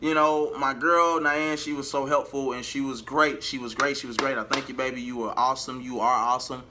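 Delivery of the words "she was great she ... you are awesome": ordinary delivery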